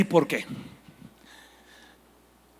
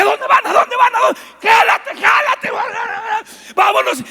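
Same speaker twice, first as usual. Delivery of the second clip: urgent shouting; 12 semitones higher, 4 dB louder than usual. Acted. Another fish urges the others.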